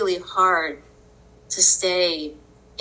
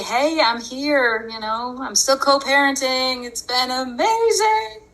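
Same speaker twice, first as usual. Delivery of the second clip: higher, sing-song fake cheer; mock cheerful; 6 semitones higher than usual.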